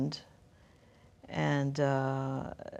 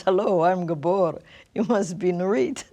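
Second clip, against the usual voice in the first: lightly imitating a Hungarian accent, a bit louder; thick Hungarian accent; 5 dB louder than usual. Retelling Gabor's first hello at Starbucks, a light Hungarian accent. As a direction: greet her like the Hungarian man she just met, accent light. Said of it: "with a thick accent".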